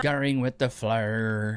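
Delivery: drawn-out Australian drawl, heavy on the vowels; exaggerated Australian imitation of the flow line; mock Australian accent. Man mimicking the woman's Australian R's, mock Australian accent. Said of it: "Have you noticed the Australian accent?".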